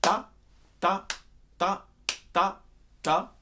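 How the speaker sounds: short, detached "ta" on a steady pulse; evenly spaced, punched "ta"; isolated ta rhythm. Shown as a demonstration. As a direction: say each "ta" as a separate rhythmic hit. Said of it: "take the rhythm that we created".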